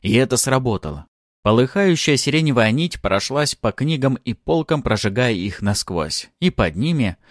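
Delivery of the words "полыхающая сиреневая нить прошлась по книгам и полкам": plain reading voice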